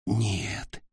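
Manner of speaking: very soft, thought not spoken; inner thought. A narrator's reading. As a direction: say it only in his head. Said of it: "подумал Ник". (мысленно, тихо)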